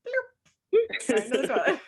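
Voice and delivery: high, sudden cartoon "bloop"; bloop sound effect. Describing the clicker dropping in, playful bloop. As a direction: pop the noise like something dropping in water.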